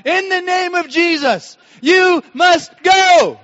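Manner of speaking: high, loud, slow commanding yell; rebuking shout. Retold or imitated. Couple rebuking intruders as demons, slow commanding shout. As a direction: cast the demons out at full voice. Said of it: "and we said".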